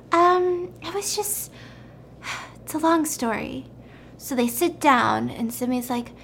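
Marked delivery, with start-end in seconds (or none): 0.1-3.6 hesitant excuse. Retold or imitated. Simi caught, fumbling for excuse.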